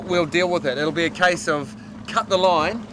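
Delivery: normal talking voice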